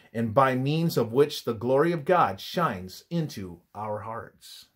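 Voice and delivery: usual speaking voice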